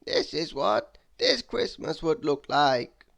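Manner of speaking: high, slow, solemn wizard; wizard's voice. Acted. Wizard showing Mister Mean the past, solemn and high. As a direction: warn him gravely of what may come. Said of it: "replied the wizard".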